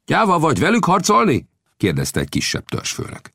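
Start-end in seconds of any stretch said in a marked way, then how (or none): 0.1-1.5 high, provocative question; taunting challenge. A narrator's reading. A lesser chief questions Old Death's courage, tauntingly. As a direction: challenge his courage, pitch raised high.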